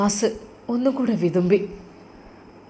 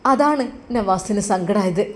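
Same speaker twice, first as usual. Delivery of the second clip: ordinary delivery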